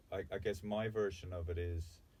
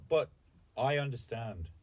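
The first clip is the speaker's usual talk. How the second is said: ordinary delivery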